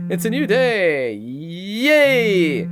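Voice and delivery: mock cheer.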